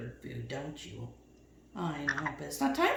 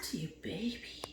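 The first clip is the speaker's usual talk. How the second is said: whisper; 11 dB quieter than usual. Real, not acted. Whisper to parrot.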